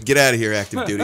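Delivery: teasing shout. Real, not acted. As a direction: heckle a friend.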